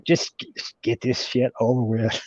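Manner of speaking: low, quiet grumble to himself; muttered under breath. Retold or imitated. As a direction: mumble it, resigned. Inner thought, grim resignation.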